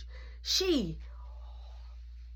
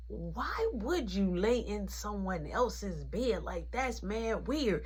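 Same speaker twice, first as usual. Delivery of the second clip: soft, slow inner thought; 4 semitones lower, 7 dB quieter, slower than usual. Retold or imitated. Watching mom scold Beth, thinking it quietly. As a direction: think it to yourself, low and slow. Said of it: "Now in my mind".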